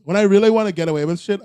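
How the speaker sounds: ordinary delivery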